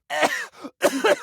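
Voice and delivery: single wet cough. Acted. Collector coughs mid-rant about phlegm, coughing. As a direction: clear the throat with a rough cough.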